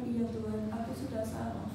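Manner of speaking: bow your head and admit it softly; soft confession. Acted. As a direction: believer humbly confessing to God, dropping to a soft voice. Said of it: "Tapi katakan".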